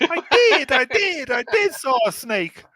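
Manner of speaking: very high, loud squeaky cartoon bird. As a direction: squeal it like a tiny cartoon canary. Cartoon bird "putty tat" parody.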